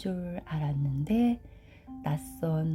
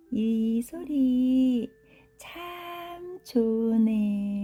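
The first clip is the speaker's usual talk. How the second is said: high, very slow drawn-out squirrel voice; contented baby squirrel; 7 semitones higher, 3 dB louder, much slower than usual. Acted. Baby squirrel with eyes closed enjoying the rain, slow high contented voice. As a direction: stretch it out high and dreamily.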